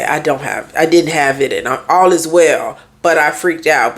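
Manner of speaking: ordinary talk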